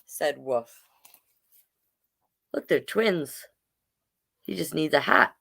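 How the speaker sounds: ordinary delivery, nothing marked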